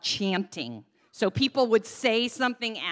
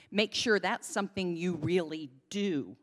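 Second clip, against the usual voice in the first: ordinary talk, nothing marked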